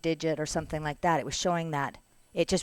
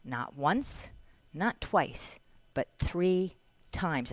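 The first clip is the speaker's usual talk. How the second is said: üç kez vurgulu, yavaş; slow emphatic count; much slower than usual. Real, not acted. Listing the lost paperwork.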